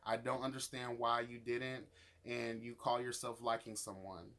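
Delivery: ordinary delivery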